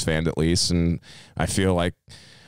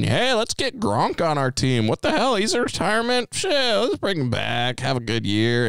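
carefree Brady; 9 semitones higher than usual. Retold or imitated. Friend imitates Tom Brady recruiting Gronk, high carefree mock voice. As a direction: play him loose and carefree, pitched way up.

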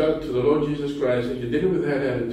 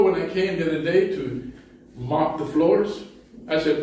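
usual speaking voice

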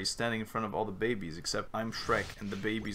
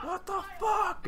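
high incredulous quote; slower than usual. Retold or imitated. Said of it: "He's like".

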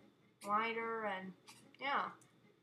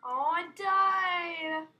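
high, loud, long-drawn cry; dramatic wail; 6 semitones higher, 11 dB louder, much slower than usual. Pretend. Gamer killed by the giant, dramatic wail. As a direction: wail it out slowly in defeat. Wailing at death in game.

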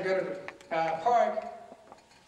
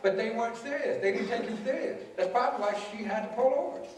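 ordinary talk, nothing marked

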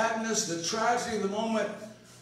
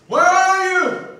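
anguished cry; 11 semitones higher, 14 dB louder, slower than usual. Retold or imitated. Crying out to God.

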